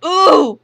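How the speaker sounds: loud oof sound effect; a short punchy game oof; hard landing oof. Pretend. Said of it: "See, you do it good".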